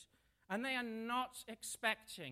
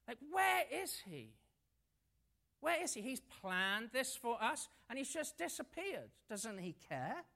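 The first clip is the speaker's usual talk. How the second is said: higher, pleading, frustrated; disciples' complaint; 3 semitones higher than usual. Acted. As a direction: plead like abandoned men.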